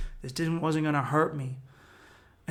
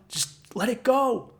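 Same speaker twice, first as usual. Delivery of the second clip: calming; 8 semitones higher, 4 dB louder, slower than usual. Real, not acted. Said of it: "make my brain go silent".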